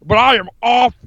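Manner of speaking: chinless-sounding tough-guy impression; hammed-up off-grid politician; Jesse Ventura. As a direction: bluster like a wrestler with no chin. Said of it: "it sounds like you don't have a chin".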